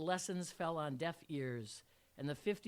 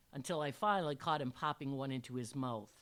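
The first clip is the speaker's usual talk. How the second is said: ordinary delivery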